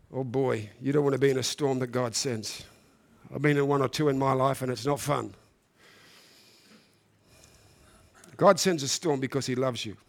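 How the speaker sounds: dropped low and soft, heavy; quiet grave aside. Acted. Preacher recalling his own storms, low and soft. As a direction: say it low like a confession.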